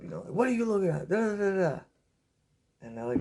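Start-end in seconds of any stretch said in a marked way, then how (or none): 0.3-1.8 mock fired-up confrontation, pitched much higher; imitating a worked-up hothead. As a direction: challenge them in a high, worked-up voice, not shouted. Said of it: "My brother's all fired up".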